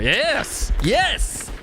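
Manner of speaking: high, rising yes, yes, yes; excited cheer. Real, not acted. Delighted at dog.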